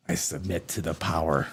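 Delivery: soft, low mock submission; mock surrender. War College head yielding to the girls, hushed and mocking. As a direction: hand over power in a hushed, defeated voice. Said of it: "repeats the quote".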